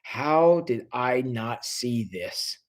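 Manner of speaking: slow, low, soft self-reproach; Craig, years later, regretting it to himself; quiet regret. Retold or imitated. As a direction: ask yourself the question you can never answer. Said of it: "Craig is gonna be saying to himself".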